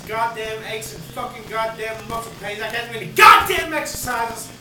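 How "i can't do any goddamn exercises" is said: sudden loud angry shout; angry outburst. Real, not acted. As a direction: bark it out in pain and frustration. Ranting about aches.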